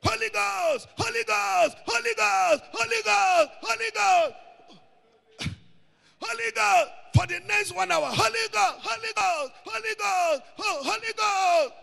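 high, urgent repeated call to the Holy Ghost; high fervent invocation. Shown as a demonstration. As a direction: cry out again and again in fervent prayer. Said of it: "demonstrating calling the Holy Ghost".

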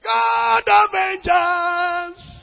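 long, high, loud wail-like shout; shouted prayer cry. Retold or imitated. Praying person disturbing the neighbourhood, drawn-out shout. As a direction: stretch every word in a hammed-up prayer shout. Mimics noisy midnight prayer.

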